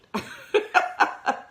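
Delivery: high airy burst of laughter. Real, not acted. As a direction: laugh out loud at the thought. Laughs at her own quote.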